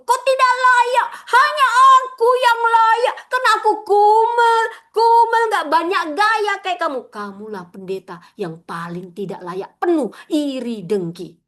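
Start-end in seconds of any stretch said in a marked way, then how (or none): 0.0-7.0 very high, loud sneering taunt, half sung; sing-song jeer of a jealous rival; mocking envious voice. Preacher voicing an envious heart belittling others, high singsong sneer. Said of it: "Kalau ada di hatimu".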